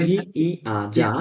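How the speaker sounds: ordinary talk, nothing marked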